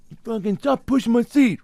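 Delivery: high, strained mock outburst; imitating a seatback-kicked passenger, high mock outburst; mock angry passenger. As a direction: bark it like an annoyed passenger. Angry airplane passenger quote.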